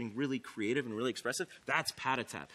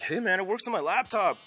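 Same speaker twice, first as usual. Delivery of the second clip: higher, louder imitation of a thrilled user; excited discovery; 6 semitones higher, 5 dB louder than usual. User telling the developer about his laptop, excited discovery. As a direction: call it out like a surprised fan.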